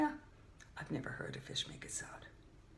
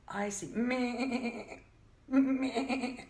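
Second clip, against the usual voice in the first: slow, stretched bleating "meh"; sheep bleat; 4 semitones higher, 8 dB louder, much slower than usual. Acted.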